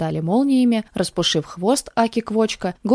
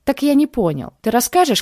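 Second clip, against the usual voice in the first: loud, high angry demand; 8 semitones higher, 5 dB louder than usual. Acted. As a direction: demand an answer angrily. Angry man interrogates.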